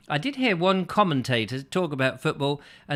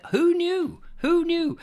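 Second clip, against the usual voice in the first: high-pitched; 3 dB louder, slower than usual. Pretend. Mock surprise, high.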